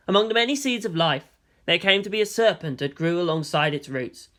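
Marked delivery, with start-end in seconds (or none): none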